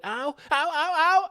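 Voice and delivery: rising high "ow, ow, ow, ow"; imitated cries of pain. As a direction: imitate someone being bumped by a car. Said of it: "you hear her going".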